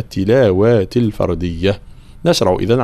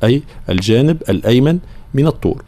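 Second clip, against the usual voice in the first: usual speaking voice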